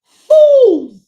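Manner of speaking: Fools yelled high and loud; furious shout. Real, not acted. Scolding supporters who spread lies, high furious yell. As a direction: throw the word Fools at them, high and loud. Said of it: "[name] sounds like she got a lot of passion".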